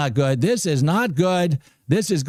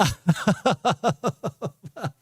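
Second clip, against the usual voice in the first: giddy laugh; 4 semitones higher, 4 dB quieter than usual. Real, not acted. Overjoyed trader.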